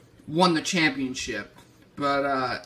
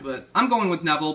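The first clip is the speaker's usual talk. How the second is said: normal talking voice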